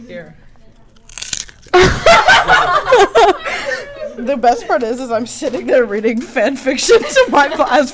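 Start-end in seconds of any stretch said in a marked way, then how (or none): 0.4-4.1 high, loud peals of laughter; loud laughing fit. Real, not acted. Cracking up with friends over the podcast, loud high laughter. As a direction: burst into long helpless laughter.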